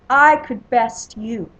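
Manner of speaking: slow, loud challenge; loud boast. A narrator's reading. Warrior answers a rival's insult, slow loud boast. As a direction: throw it down slowly and loudly.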